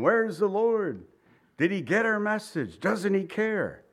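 imagined plea, stretching the key words; imploring questions. Retold or imitated. Preacher voicing Mary and Martha waiting for Jesus, mildly imploring. Voicing worried sisters' thoughts.

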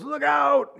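sudden high shout of alarm; warning shout. Retold or imitated. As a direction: yell it out to warn a friend. Friend warns of a snake.

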